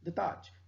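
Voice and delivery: soft द ताज with ताज still hit; quiet द ताज, weight on ताज; light द, stressed ताज. Shown as a demonstration. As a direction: repeat द ताज landing on ताज. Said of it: "हम ऐसे बोलेंगे द ताज".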